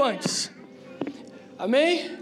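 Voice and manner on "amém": usual speaking voice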